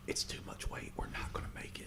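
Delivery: whisper. Acted. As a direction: breathe it out unvoiced like a frightened bird. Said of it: "is like".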